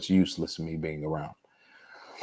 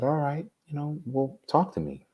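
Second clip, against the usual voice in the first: lightly higher imitation of mom; a hint of his mother's voice, pitched up; 9 semitones higher, 3 dB quieter than usual. Son retelling his mother urging him to talk, lightly higher voice.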